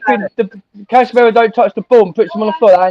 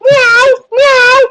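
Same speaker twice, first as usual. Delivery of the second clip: mock cat meow; 12 semitones higher than usual. Teasing a friend by meowing like a cat. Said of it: "you sound like a cat".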